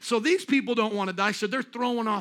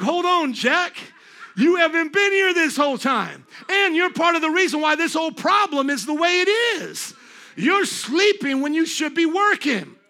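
higher, loud scolding of a dad; calling out loudly; 7 semitones higher, 6 dB louder than usual. Retold or imitated. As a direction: stop him cold and call him out.

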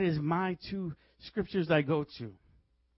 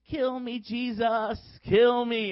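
raised, drawn-out cry to Jesus; imitated prayer plea; 4 semitones higher, slower than usual. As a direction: mimic a woman pleading aloud in prayer. Imitates woman crying out in prayer.

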